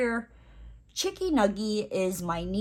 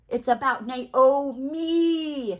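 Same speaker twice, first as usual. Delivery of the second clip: slow, higher drawn-out diva boast; mock diva; 6 semitones higher, 4 dB louder, much slower than usual. Joking to viewers, slow drawn-out diva boast. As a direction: stretch it out like a spoiled star. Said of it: "No, I'm joking".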